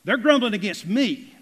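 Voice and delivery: loud, high burst landing on "me"; angry God. Acted. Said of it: "God just gets angry".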